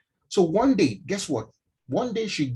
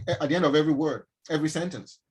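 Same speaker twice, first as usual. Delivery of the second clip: ordinary talk, nothing marked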